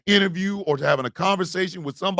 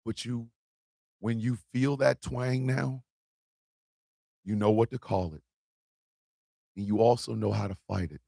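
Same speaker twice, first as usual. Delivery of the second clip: quiet, lower, comforting tone; soft reassurance; 9 semitones lower, 7 dB quieter than usual. Real, not acted. Comforting viewers who feel the twang, quiet and low. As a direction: console them gently.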